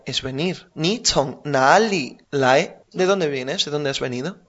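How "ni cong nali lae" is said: slow and careful, "nali" rising at the end; slow teacher demonstration of the question; slow model phrase. Shown as a demonstration. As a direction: spell it out slowly for a learner. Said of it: "si te digo".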